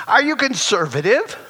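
normal talking voice

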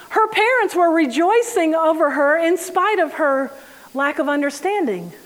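normal talking voice

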